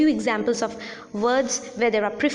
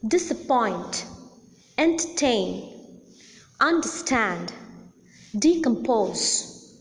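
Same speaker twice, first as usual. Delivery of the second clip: disapPOINT, enterTAIN, underSTAND, decomPOSE; last-syllable stress; slower than usual. Shown as a demonstration. Teacher lists Disappoint, entertain, understand, decompose, main stress on the last syllable. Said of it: "the last syllable or the third syllable is the most".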